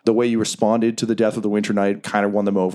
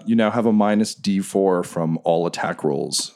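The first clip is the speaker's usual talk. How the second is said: ordinary talk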